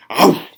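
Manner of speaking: sudden loud ow; loud yelp. A narrator's reading. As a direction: yelp as the jaws snap on water. Coyote lunges into water.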